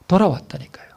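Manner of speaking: intense hushed whisper. Real, not acted. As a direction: whisper the warning. Preacher whispers the point.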